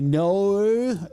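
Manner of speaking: Delco-style "no" with a long gliding O; Delco accent "no". Shown as a demonstration. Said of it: "how they say".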